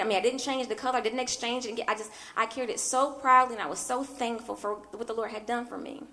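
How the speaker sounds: ordinary talk, nothing marked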